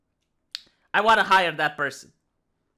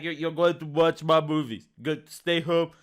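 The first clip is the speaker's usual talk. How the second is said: low, badly faked Austrian accent; Arnold Schwarzenegger; 6 semitones lower, 4 dB quieter than usual. Film star telling viewers to stay home, low mock Austrian accent. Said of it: "like, the Terminator face".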